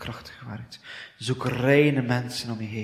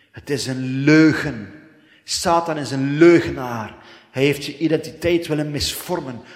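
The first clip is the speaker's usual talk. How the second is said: preaching shout; 3 semitones higher, 8 dB louder than usual. Real, not acted. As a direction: bellow it out as a rebuke. Preacher shouts rebuke at Satan.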